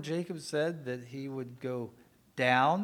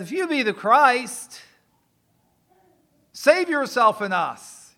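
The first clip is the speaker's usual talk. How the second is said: mocking thief; 8 semitones higher, 14 dB louder, slower than usual. Thief on the cross mocks Jesus.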